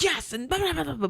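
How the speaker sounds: high-pitched snappy yes into blah; imitated irate babble; mock angry caller. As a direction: mimic a yelling guest. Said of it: "he just continues to yell".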